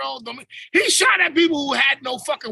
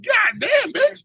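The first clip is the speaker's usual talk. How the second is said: high, loud mock yell; hammed-up high outraged shout; 11 semitones higher, 3 dB louder, much slower than usual. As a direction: yell it like she cheated you. Mock outrage at coworker's bonus.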